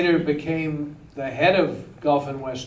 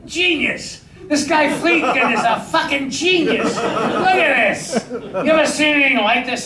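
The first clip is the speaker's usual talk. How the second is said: put-on high, excited thick Austrian accent; crazed billionaire exclaiming in a thick Austrian accent; Charlie Bludorn's Austrian accent; 8 semitones higher than usual. Studio boss raves about the director's plan, thick Austrian accent. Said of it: "that's exactly how he talked".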